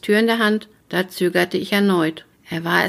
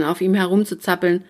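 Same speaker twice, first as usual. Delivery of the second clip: ordinary delivery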